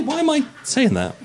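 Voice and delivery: ordinary talk, nothing marked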